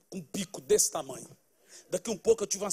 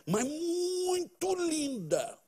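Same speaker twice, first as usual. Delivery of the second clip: a light, slow, drawn-out grandma voice, higher than his own; admiring grandma voice; 7 semitones higher, 3 dB quieter, much slower than usual. Retold or imitated. Grandma selling the girl to her grandson, a drawn-out admiring coo. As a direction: coo it like a doting granny.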